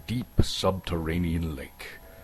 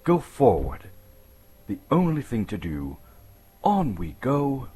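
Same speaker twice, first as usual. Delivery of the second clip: louder, clipped-free firm resolve, rising at the end; resolute self-talk; 4 semitones higher, 7 dB louder than usual. Acted. Lost hobbit in the dark tunnel urging himself onward, loud and determined. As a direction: talk yourself into going forward, boldly.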